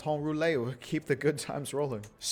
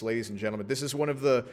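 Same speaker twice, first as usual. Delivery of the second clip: ordinary talk, nothing marked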